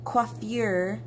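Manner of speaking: Coiffure drawn out slowly, French-style kwah-FYOOR with a rising end; fancy coiffure. Shown as a demonstration. Artist fussing over the word, says Coiffure carefully with a rising end. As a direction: try to make Coiffure sound decent. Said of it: "Coiffure sounds as though I'm attempting".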